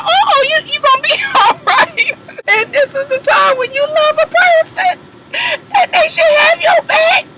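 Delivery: high, far-off mock sob, stretched out slow; mock crying. Comforting a crying woman on TV, mock sobbing. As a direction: wail it like you're crying along with her. Speaker mock-cries along with her.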